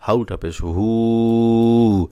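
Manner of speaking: হু held long and slow; drawn recitation. Shown as a demonstration. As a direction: stretch the হু as in recitation. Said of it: "এখানে টান হবে".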